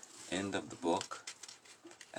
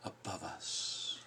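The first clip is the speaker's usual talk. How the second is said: softer, breathy, slowed ending; hushed close; 6 dB quieter, much slower than usual. Acted. Ending the passionate line, soft hushed close. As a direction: let the storm die to a hush.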